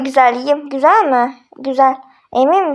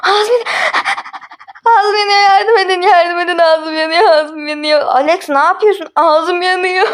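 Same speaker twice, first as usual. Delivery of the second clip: hammed-up burning mouth; 4 semitones higher than usual. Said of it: "Alex tövbe abartmadın mı?".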